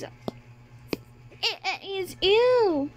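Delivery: teasing imitation of a nervous introduction; mocking stutter. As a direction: mimic her stumbling, stretching it out. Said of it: "Stop mocking her".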